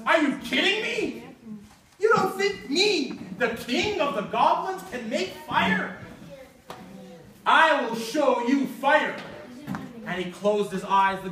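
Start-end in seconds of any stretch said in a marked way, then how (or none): 0.0-9.1 higher voiced goblin, offended and boastful; indignant goblin king. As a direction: scoff like a proud goblin who's been insulted. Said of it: "The goblin says".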